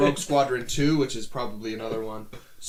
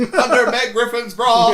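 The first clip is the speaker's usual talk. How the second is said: mock announcer; 10 semitones higher, 11 dB louder, slower than usual. Mock dramatic announcer intro.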